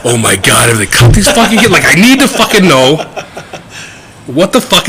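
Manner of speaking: giggling through exasperated words; laughing while talking. Real, not acted. Laughing at own obsessive Googling.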